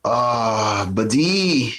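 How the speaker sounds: high, hammed-up French-accented excuse; a smug mock Frenchman backing out. French voiced breaking promise.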